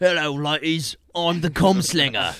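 slow, high, loud sleazy villain drawl; hammed-up villain. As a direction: savour every word like a creepy villain.

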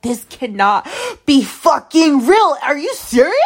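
high-pitched, hammed-up disbelief; incredulous outburst. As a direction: squeal it in retold disbelief. Retold disbelief at his reaction.

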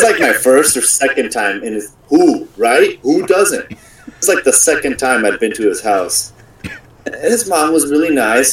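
ordinary talk, nothing marked

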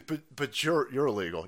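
usual speaking voice

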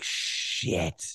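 low, very quiet muttered curse; retold sinking dread, barely voiced; hushed dismay. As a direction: let a sinking curse slip out under the breath. Dismayed reaction to dead car battery.

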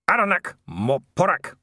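loud, high, nasty dwarf voice. A narrator's reading. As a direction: spit the foreign words out nastily. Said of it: "but the nasty tone was unmistakable".

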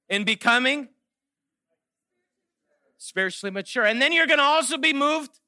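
loud, high, rallying preacher calls; preaching at full force. Acted. Pastor rouses his congregation at the close, preaching loudly. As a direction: call the crowd to answer.

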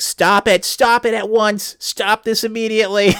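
high, urgent indignant shouting; outraged teacher. Retold or imitated. Teacher halting a student skit, outraged and high-pitched.

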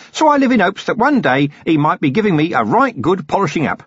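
a cheerful, long-winded hermit eager to explain; chatty old hermit. Acted. Hermit on family tradition.

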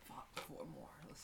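under-breath whisper; whispered curse while thinking. Real, not acted. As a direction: say it to yourself, unvoiced. Muttered to himself.

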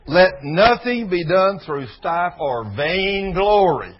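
slow, loud preaching of the verse; preached scripture. Acted. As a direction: proclaim the verse, word by word. Reads scripture with force.

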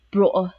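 "bro" loud with a big, thick vowel; larger Geordie vowel. Shown as a demonstration. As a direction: punch out "bro" as the Geordie start of brother. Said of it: "become more larger".

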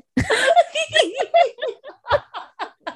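cracks up high after the line; high burst of laughter. Real, not acted. After reading the romance line to her friend, bursting into laughter.

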